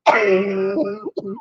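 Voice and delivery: real hacking cough; coughing fit. Real, not acted. Woman choking after laughing too much, coughing fit. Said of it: "gonna have a coughing fit".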